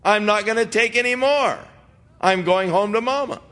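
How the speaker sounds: lightly voiced exasperated wife; imitated fed-up wife. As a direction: act out a wife who has had it. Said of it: "the wife says".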